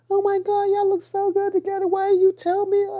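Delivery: very high, gushing mock mom voice; excited mom impression. Son imitates his excited mom on FaceTime. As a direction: gush like a thrilled mother. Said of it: "my mom is so excited".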